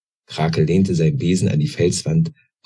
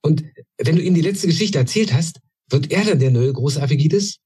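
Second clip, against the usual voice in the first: much higher, urgent little-goblin questions; eager young goblin; 10 semitones higher than usual. A narrator's reading. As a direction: press him hard for an answer. (viel höher, drängender kleiner Kobold)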